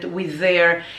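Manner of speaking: normal talking voice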